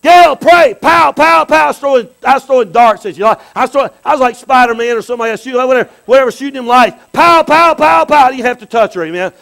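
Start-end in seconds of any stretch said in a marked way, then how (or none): 0.1-1.6 higher, louder playful shout; excited shout. Acted. Throwing pretend lightning bolts. 7.1-8.3 higher, louder mock zaps; playful blast noises. Shooting lights like Spider-Man.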